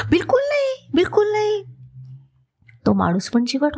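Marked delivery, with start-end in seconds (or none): 0.1-1.6 much higher, insistent protest; emphatic denial. Acted. Stubborn relative cutting off Mulla's excuse, high and insistent. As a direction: shoot it down in a high, eager voice.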